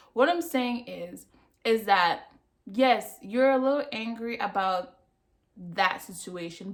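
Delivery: ordinary talk, nothing marked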